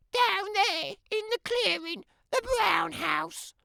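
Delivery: put-on a sweet, self-admiring child's voice; vain little girl. Little Red voiced by narrator.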